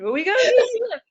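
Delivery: put-on high, fast Alabama accent; a squeaky Alabama accent. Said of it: "that's my best impression".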